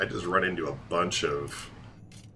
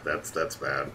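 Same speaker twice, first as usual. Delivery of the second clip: normal talking voice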